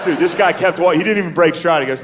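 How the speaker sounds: usual speaking voice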